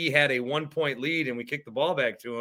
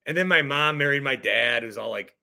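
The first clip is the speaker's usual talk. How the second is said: ordinary talk, nothing marked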